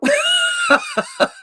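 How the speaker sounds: sudden high-pitched burst of laughter. Real, not acted. Laughing at the absurd exchange.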